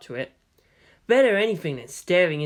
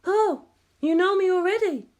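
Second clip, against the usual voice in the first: much higher, bright surprised female voice; perked-up surprise. A narrator's reading. Kodama is surprised Makoto knows her, higher and perked up. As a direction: perk up, pleased to be recognised. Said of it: "She perked up".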